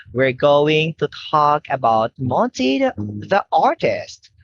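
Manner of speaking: normal talking voice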